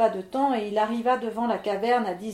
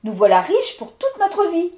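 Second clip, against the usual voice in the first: high, louder joyful cry; overjoyed wife; 8 semitones higher, 8 dB louder than usual. Acted. Claudine seeing the gold tonneau, delighted cry. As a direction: gush with delight.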